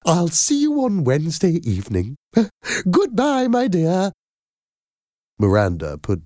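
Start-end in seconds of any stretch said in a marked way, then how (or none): 0.0-4.1 high, giggly, effusive sign-off; Madam Doubtfire. A narrator's reading. Nanny ending the call, gushing and happy. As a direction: say goodbye in a fond, high nanny voice. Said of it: "said Madam Doubtfire".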